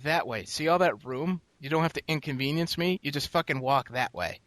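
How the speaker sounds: pointing a rude guy to the open space; retold scolding. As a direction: talk down to him like a child. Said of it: "I was like".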